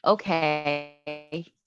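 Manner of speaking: low, quiet, drawn-out okay; dismissive okay. Retold or imitated. Texter brushing off the other person, dismissive. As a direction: draw out a soft, low okay. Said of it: "hate when people go".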